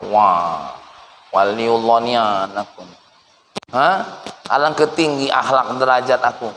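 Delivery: smug self-praise. Retold or imitated. Someone praising himself after greeting guests, smug. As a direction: admire yourself a little too much.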